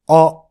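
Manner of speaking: あ pronounced far back in the throat; throaty French back あ; back-of-throat vowel. Shown as a demonstration. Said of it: "喉の奥で発音する".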